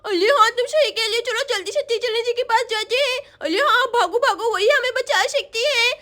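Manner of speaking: put-on eager, urgent little-girl chatter; excited little schoolgirls. Two girls spot the rescuing school bus, excited child voices.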